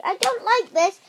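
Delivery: high, loud protest; shouting. A narrator's reading. Rosie protesting her transformation, shouting high and loud. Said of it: "shouted Rosie".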